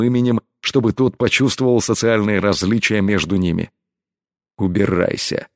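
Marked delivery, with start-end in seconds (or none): 4.6-5.5 understated angry growl. A narrator's reading. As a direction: order them away in a restrained growl. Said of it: "прорычал разгневанный Элай".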